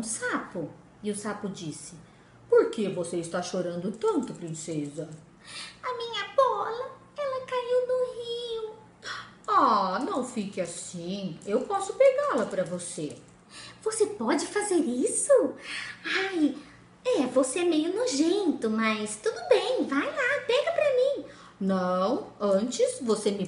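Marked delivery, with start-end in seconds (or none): 2.5-5.1 low, gentle frog voice; a low-voiced frog asking kindly. Frog speaks to princess. 5.8-8.7 high, whiny princess voice; a pampered princess whining over her lost ball; upset princess. Acted. Princess answers frog. 9.5-13.2 low, gentle frog voice; a low-voiced frog asking kindly. Frog offers help. 13.8-21.3 spoiled princess. Acted. Princess disgusted but eager.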